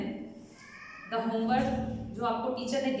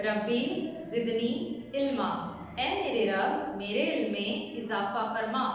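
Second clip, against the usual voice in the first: normal talking voice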